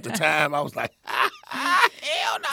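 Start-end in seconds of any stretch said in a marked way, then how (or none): 1.5-2.5 very high-pitched exclamation; mock alarm. Comedian acting out his reaction to her first cry, high alarmed. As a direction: yelp it in comic disbelief.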